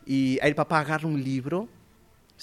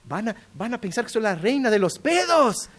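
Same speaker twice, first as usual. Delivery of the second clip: higher, louder, indignant complaint; mock indignant wife; 8 semitones higher, 7 dB louder than usual. Wife objects to the dedication, mock outraged and loud. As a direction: protest like an offended wife. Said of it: "Se quedó enojada, me dijo".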